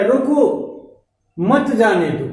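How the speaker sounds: "रोको" rising, clear pause, then "मत जाने दो"; pause after रोको. Shown as a demonstration. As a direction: break after रोको, let मत जाने दो fall. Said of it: "इस तरीके से बोला जाए".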